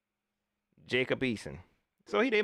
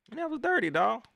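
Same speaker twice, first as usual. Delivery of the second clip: indignant; 13 semitones higher than usual. Real, not acted. Reacts to dirty play.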